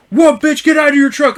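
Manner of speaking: high, loud goading imitation; retold angry challenge. Other driver daring the teller out, retold loud and angry. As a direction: act out daring someone to fight.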